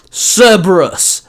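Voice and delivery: slow, loud, high battle cry; called out. Acted. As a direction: bellow the name. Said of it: "Adam called out".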